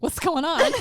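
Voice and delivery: high, loud mock bewilderment; bewildered kid. Toothless kid caught in the family scene, loud bewildered mock cry. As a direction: cry out like a baffled child. Acting herself as a bewildered child.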